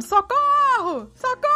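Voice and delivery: high, very slow parrot cry of socorro; a parrot shrieking for help from a shut flat; parrot screaming for help. Retold or imitated. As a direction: squawk it out like a trapped parrot. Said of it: "Uma voz gritando".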